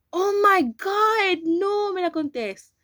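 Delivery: high, loud, slow disbelieving cry; stunned outcry. Real, not acted. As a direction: yelp it out, can't believe it. Reacting as telescreen revealed.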